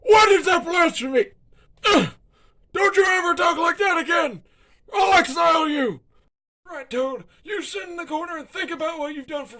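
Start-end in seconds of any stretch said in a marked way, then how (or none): 0.0-6.0 loud, high rant; furious shout. Acted. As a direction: blow up at him in outrage. Dictator outraged at charity.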